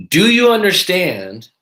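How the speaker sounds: drawn-out patronizing "do you understand?"; condescending. Retold or imitated. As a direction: talk down to him like a child. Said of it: "the condescending voice and talking over me".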